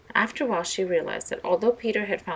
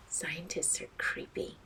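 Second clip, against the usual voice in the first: slow, breathless whispered aside; a creepy secret whisper; 9 dB quieter, slower than usual. Acted. Anthony whispering his suspicion of scientists, slow whisper.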